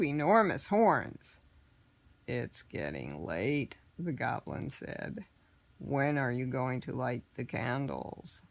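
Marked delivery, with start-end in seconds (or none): 2.3-8.4 much lower, softer, slow goblin voice; low and patient, a scheming goblin; horned goblin prodding quietly; sly goblin. Acted. Red-faced horned goblin speaks.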